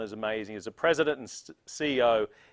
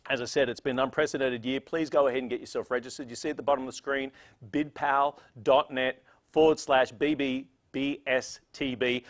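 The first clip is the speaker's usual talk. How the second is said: usual speaking voice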